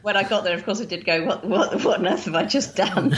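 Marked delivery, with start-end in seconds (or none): 1.2-3.2 higher-pitched, laughing mock dismay; laughing disbelief. Guest recalling her rash move abroad to host, laughing disbelief. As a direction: say it through a laugh, aghast at yourself.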